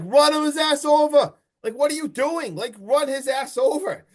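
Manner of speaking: panicked shout to floor it; urgent yell. Retold or imitated. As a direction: yell at her to run him over. Retelling Sam yelling at driver.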